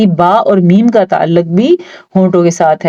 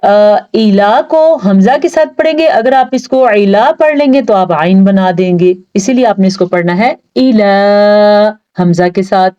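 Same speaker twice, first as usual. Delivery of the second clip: ordinary talk, nothing marked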